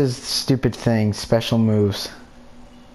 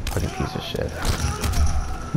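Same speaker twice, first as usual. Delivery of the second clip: slow, hissed whisper of a curse; real seething whispered swear; 6 dB quieter, slower than usual. Real, not acted. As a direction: spit it out under your breath. Cursing under breath at opponent.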